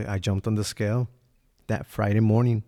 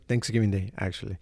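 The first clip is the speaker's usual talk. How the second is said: ordinary delivery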